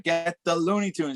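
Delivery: lightly voiced cheer call; cheer lead-in. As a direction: start the cheer casually. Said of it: "he does a cheer".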